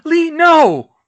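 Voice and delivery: high, loud horrified scream; anguished scream. Acted. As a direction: scream in horror. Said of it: "Fred screamed".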